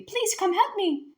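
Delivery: high and slow pleading; plaintive plea. Acted. Trapped dragon to the girl, slow plaintive plea. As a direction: draw out the plea. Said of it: "the voice asked plaintively".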